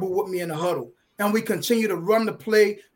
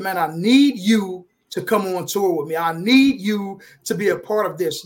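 "need" punched out louder, both times; stress on need; 4 dB louder than usual. Retold or imitated. Brother asking brother to join the tour, stress on "need". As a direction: lean hard and long on the word "need". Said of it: "Notice I'm emphasizing the word need".

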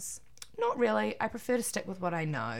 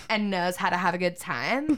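normal talking voice

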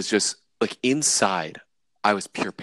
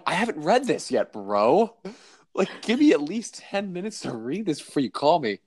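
exasperated; 7 semitones higher than usual. Retold or imitated. Retold protest to email caller.

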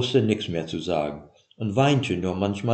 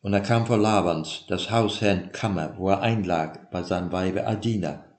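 ordinary delivery, nothing marked

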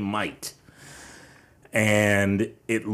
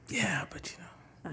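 whisper; 9 semitones higher, 11 dB quieter, slower than usual. Real, not acted. Half-joking reply after the story.

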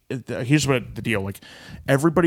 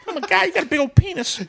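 put-on Brooklyn accent; 5 dB louder, faster than usual. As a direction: gush it in a squeaky Brooklyn voice. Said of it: "Give her that stupid Brooklyn accent".

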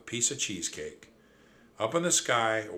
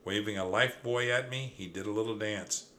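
ordinary delivery

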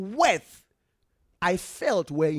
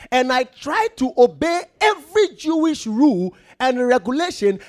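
loud, raised preaching read; forceful scripture reading; 7 semitones higher, 11 dB louder than usual. Acted. Preacher to congregation, loud scripture read. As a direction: proclaim the verse to the church.

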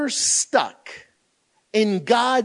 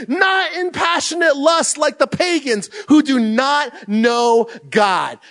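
bold reading aloud; 5 semitones higher, slower than usual. Retold or imitated. Keeps reading after they stop.

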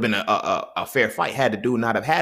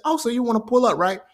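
taunting brother; 11 semitones higher than usual. Retold or imitated.

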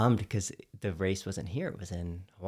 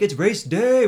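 excited announcement; 10 semitones higher, 8 dB louder, slower than usual. Retold or imitated. Pumped up following race day.

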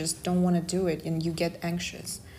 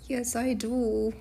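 higher, drawn-out attempt at a Kazakh comic accent; Borat; 5 semitones higher, slower than usual. Shown as a demonstration. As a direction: stretch the words in a badly faked Borat accent. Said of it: "I can do Borat accent".

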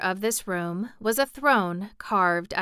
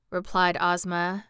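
usual speaking voice

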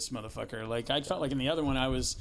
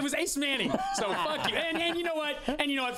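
much higher, excited yelling of the name; triumphant outburst; 14 semitones higher than usual. Real, not acted. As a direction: blurt it out like you finally won.

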